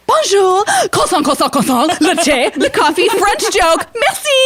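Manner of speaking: over-the-top French. Retold or imitated. Canadian woman at the cafe, hammed-up high French show. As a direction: show off every French word you know. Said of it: "she's like".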